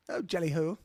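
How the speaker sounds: jolly mock-British accent. Shown as a demonstration. Co-host answering "United Kingdom" on request, British accent. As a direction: put on a hearty British accent. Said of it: "do the accent for those names".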